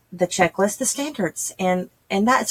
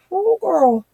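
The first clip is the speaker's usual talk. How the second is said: put-on higher, excited "oh girl" to a friend; gushing bestie voice; 7 semitones higher than usual. Tutor tells her bestie, higher, excited girlfriend voice. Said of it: "They're like".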